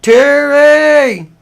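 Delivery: re-enacted long, high, loud call; yelled out. Man calling to Terry out of sight, yelled out. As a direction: call his name out high, drawn out and loud. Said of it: "and I yelled out".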